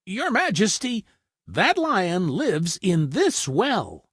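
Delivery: high then slow, pointing the way; a clever rabbit baiting the trap; sly rabbit. A narrator's reading. Rabbit tricking the lion, high and slow.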